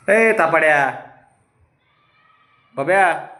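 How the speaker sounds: teasing. Real, not acted. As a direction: tease him fondly. Teasing pet as liar. (उंच लाडिक चिडवणे)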